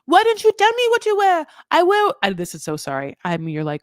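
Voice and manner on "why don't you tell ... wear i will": put-on very high, loud French-accented wail; mock an upset French wife, pitched way up; hammed-up French accent, loudly complaining; Manon in bad French accent. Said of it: "My French accent is, you know, leaves a lot to be desired".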